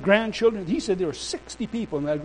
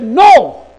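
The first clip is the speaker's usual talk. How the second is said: raised, booming retort; shouted final refusal; 6 semitones higher, 15 dB louder than usual. Retold or imitated. Preacher having last word against minister, defiant. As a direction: end the argument at full voice. Acted yes-no shouting match.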